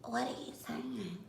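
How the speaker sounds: soft, quizzical Beth imitation; imitating Beth. Imitating Beth from Good Girls, soft and puzzled. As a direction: mimic her, soft and puzzled. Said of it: "And she like".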